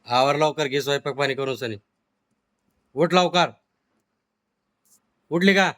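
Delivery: scolding yell. Acted.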